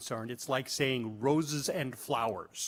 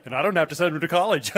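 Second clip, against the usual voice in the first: dog's thought; 3 semitones higher, 9 dB louder, much faster than usual. Retold or imitated. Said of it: "your dog looks at you like".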